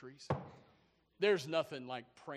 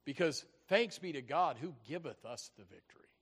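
ordinary talk, nothing marked

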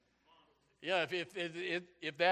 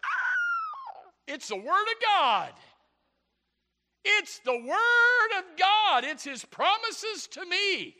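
high, very loud, drawn-out preacher's cry; acted-out burst of joy at full volume; ecstatic shout; 11 dB louder, much slower than usual. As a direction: shout it slowly in rapture, stretching the words. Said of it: "I open it up and I go".

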